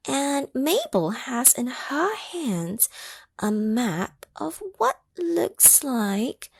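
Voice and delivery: ordinary delivery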